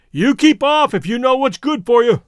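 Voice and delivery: shouted warning, high and loud; loud threat. A narrator's reading. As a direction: bellow a threat across the water. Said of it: "he called out suddenly".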